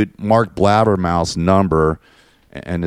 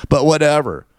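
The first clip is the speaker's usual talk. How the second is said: ordinary delivery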